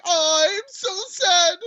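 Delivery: high, whiny mock crying; mock Mai's self-pity with a fake sob; mock whining Mai. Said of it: "She was like".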